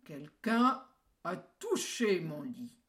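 big bear bellowing, loud and slow; grosse voix du grand ours. A narrator's reading. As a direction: roar it like a huge papa bear. (grosse voix d'ours qui tonne)

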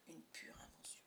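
hushed whisper, almost no voice; soft whispered aside. A narrator's reading. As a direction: breathe it out as a secret. Narrator hushes reassuring line.

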